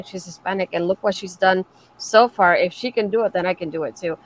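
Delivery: ordinary talk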